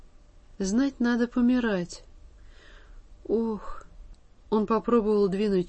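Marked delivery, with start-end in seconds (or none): none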